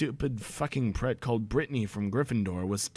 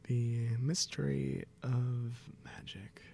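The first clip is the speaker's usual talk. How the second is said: whisper; 3 semitones lower, 9 dB quieter, slower than usual. Acted. Dramatic whispered opener.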